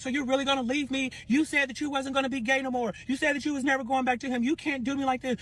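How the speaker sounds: high, put-on pleading voice; jilted lover. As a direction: act out the rival's wounded protest. Jilted rival voiced pleading on phone.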